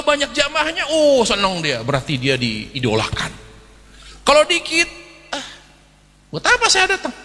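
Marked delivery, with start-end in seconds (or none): 4.2-7.1 high, sulky complaint of a neglected preacher. Acted. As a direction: whine it like a disappointed ustadz. Acting the sulking preacher. (ustadz merajuk, nada tinggi)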